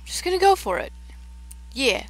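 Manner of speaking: high, loud go for it; excited. Real, not acted. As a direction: psych yourself up loudly. Committing to a jump.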